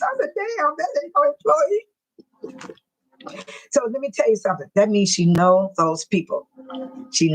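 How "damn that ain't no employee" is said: high-pitched, incredulous exclamation; shocked realization. Retold or imitated. Retelling how she learned the woman wasn't staff, shocked. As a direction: re-enact sudden disbelief in a raised pitch.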